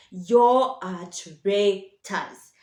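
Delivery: each word dragged out and leaned on; slow, heavy "You are traitors"; slow accusation. Real, not acted. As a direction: spell out the accusation slowly, word by word. Fan scolding fans who'd sell Bruno.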